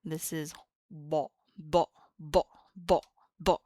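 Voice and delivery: "ba" with a short, heavy emphatic consonant; emphatic D-Z sound. Shown as a demonstration. Teacher demonstrating a letter, loud repeats. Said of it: "It's almost like if this one".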